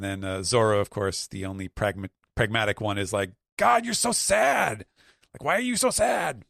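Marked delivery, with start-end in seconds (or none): 3.6-6.4 high, louder exasperated yell; mock yelling. Zoro scolding the sad crew, high mock exasperated yell. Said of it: "And yelling at them".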